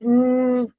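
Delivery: drawn-out, level "hmm"; thinking hum. Shown as a demonstration. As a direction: hum as if searching for the answer. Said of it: "Then thinking". (सोचते हुए लंबा "हम्म")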